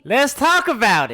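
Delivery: high, drawn-out shout; slow, booming call to open the debate; loud interjection. Real, not acted. Host urging the others to discuss it, loud and high.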